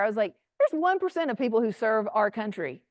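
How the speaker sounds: usual speaking voice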